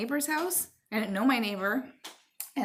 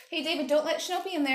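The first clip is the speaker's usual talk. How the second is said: quick, raised call to the next room; higher, hurried warning shout; 8 semitones higher, much faster than usual. Real, not acted. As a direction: call across the house to stop him. Calling to her son.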